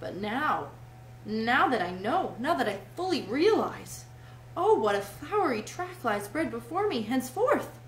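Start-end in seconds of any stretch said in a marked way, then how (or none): 1.2-7.8 grandiose rapture. A narrator's reading. Toad dreams of flinging carts in ditches, grandiose rapture. Toad rhapsodizes over his motoring future.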